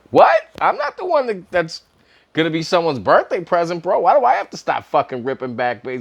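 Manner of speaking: high, incredulous retold protest; high-pitched indignant buddy; mock outraged protest. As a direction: squeak it out in disbelief. Retold protest about stopping drinking.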